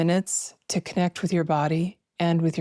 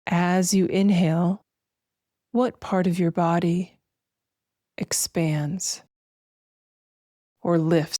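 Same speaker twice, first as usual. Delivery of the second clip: slow, spacious meditation voice with long pauses; guided meditation; slower than usual. Acted. Host guiding listeners to feel breath and weightless arms, slow meditation voice. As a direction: guide them gently through each breath, drawing words out.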